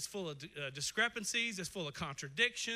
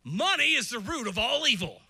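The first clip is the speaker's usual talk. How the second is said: loud misquote; 4 semitones higher, 10 dB louder than usual. Retold or imitated. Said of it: "Let's give you a couple".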